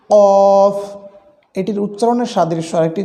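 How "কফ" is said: কফ loud, with a punched back-of-throat q; কফ as a heavy, long "qoof"; pushed articulation. Shown as a demonstration. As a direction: push hard into কফ and hold its rounded vowel. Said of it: "ধাক্কা দিয়ে উচ্চারণ করতে হয়".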